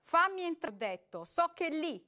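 Robert growling at the door; growled. A narrator's reading. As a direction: snarl the order at her. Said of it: "ringhiò Robert".

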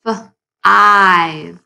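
F, then a long stretched ive; segmented five. Shown as a demonstration. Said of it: "the long I sound that goes with the word five".

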